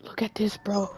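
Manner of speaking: hushed, voiceless aside; secretive whisper. Pretend. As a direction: whisper it to a friend in hiding. Hiding, showing friend something.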